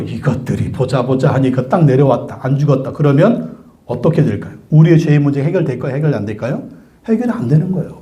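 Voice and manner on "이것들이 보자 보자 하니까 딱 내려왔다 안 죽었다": usual speaking voice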